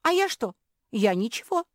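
high, loud defensive blurt; blurted patter. A narrator's reading. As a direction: fire it out in a high voice. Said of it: "скороговоркой выпалила Мафи".

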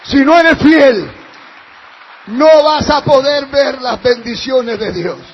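shouted with rising force; preaching shout. Real, not acted. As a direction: shout it at the congregation, slowly.

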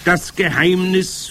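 high, loud, drawn-out proclamation; triumphant. A narrator's reading. Witch leader revealing her secret, triumphant. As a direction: announce it slowly, savouring the reveal.

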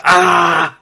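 short high mock scream; a quick shrill fan shriek. Cohost echoing the scream he just heard, loud mock shriek. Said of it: "I liked your scream".

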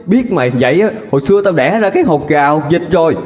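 high, fast, cutting fury; a furious mother disowning her daughter; bitter disowning. Acted. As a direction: spit out the rejection.

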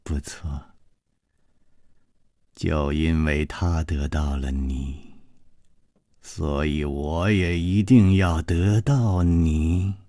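low, slow and soft; Gong Nanyan's cold reply. Acted. Gong Nanyan coldly tells her rival she wants her. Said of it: "宫南燕说：".

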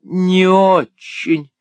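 high, loud, slowly drawn-out teasing; coy exclaim. A narrator's reading. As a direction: flirt it out, stretching the words. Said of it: "воскликнула мисс Лакриви". (кокетливо восклицает, растягивая слова)

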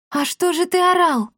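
high, questioning voice; puzzled girl. A narrator's reading. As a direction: ask in puzzled surprise, voice lifted. Girl character questions the cat.

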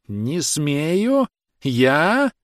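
high, stretched, incredulous questions; drawn-out howl. A narrator's reading. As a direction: wail each question upward in outrage. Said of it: "протяжно взвыл Становой". (протяжный возмущённый вой)

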